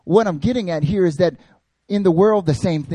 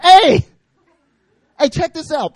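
much higher and louder, attention-grabbing call; eager shout; 13 semitones higher, 9 dB louder than usual. Retold or imitated. Peter calling out to Jesus, eager loud shout. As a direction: wave for attention like an overeager kid. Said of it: "Peter's like".

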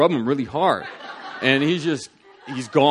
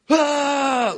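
high, drawn-out wail; imitated sudden cry; 13 semitones higher, 4 dB louder than usual. Keyboardist doubling over under the Spirit, high cry. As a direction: mimic a cry of being overcome. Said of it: "the keyboardist doubles over and goes".

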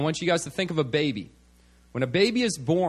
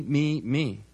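ordinary talk, nothing marked

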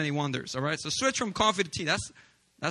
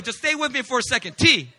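ordinary delivery

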